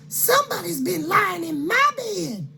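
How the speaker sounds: high fluting mama bear. Acted. Mama Bear complaining to her family, high and fluting. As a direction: squeal it up high like a fussy mother. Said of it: "cried Mama Bear".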